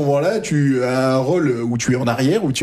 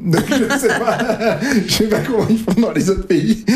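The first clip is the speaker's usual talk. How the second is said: laughing; 4 semitones higher than usual. Real, not acted. Host laughing with the band about accents, giggling. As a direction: laugh through the words.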